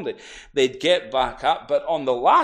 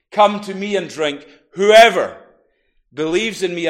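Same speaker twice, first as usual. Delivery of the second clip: booming call; 3 semitones higher, 8 dB louder than usual. Acted. Jesus calls out to the crowd, booming. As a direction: call it out over the silent crowd. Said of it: "Jesus' voice booming".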